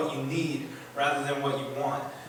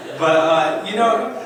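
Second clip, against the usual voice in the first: laughing; 5 semitones higher, 9 dB louder than usual. Real, not acted. Laughing while telling the story.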